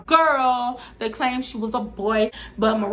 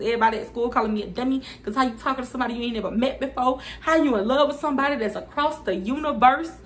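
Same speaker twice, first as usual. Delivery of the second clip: normal talking voice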